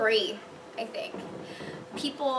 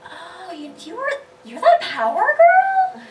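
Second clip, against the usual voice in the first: much higher, loud and slow, amazed; surprised recognition; 6 semitones higher, 8 dB louder, slower than usual. Retold or imitated. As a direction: gasp as you recognise her. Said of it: "and go".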